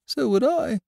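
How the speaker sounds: gentle bewildered bear. Acted. Pooh character voice.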